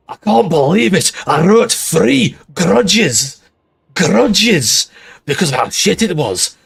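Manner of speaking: dwarf voice turned angry Scot; hammed-up angry Scottish accent; angry Scotsman. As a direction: rant like a mock-furious Scottish dwarf. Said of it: "more like an attempt at an angry Scotsman".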